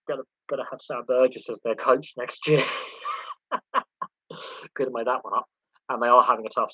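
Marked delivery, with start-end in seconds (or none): 2.7-4.1 voiceless run of laughter; breathy chuckle. Real, not acted. Streamer laughing at their own coaching joke, breathy chuckle. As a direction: chuckle quietly without voice.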